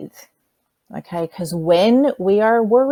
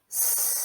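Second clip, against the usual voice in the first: soft long hissing S; snake hiss; 15 dB quieter than usual. Shown as a demonstration. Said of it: "I can show you".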